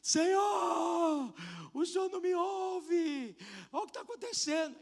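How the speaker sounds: loud, high, slow wailing cry; desperate shout. Retold or imitated. Pastor alone in church wailing at God, desperate shout. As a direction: wail at God in a holy tantrum. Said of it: "gritando, igual um bobo".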